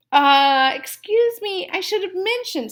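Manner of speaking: high, innocent mock-sorry voice; mock apology. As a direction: play innocent, sweetly. Dentist feigning apology to trapped fox.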